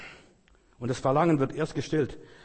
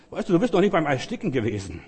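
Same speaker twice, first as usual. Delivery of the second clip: usual speaking voice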